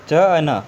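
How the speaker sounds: च अ न said slowly, each sound apart; slow letter reading. Shown as a demonstration. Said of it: "स्वर को हटा के पढ़ना है". (धीरे-धीरे अक्षर बोलना)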